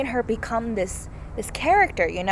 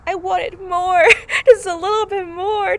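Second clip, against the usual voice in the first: high, whiny pleading for more; mock wail; 10 semitones higher, 7 dB louder than usual. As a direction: wail it loud and high, playfully tearful. Acts out wanting more scenes.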